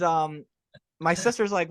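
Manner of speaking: ordinary talk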